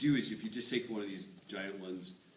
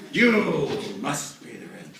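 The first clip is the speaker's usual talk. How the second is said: slow, loud, higher villain demand; melodrama villain; 6 semitones higher, 12 dB louder, much slower than usual. Pretend. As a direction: drag out the threat.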